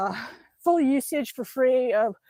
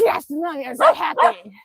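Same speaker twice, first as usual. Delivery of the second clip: dog's voice; 3 semitones higher, 3 dB louder than usual. Pretend. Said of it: "He says".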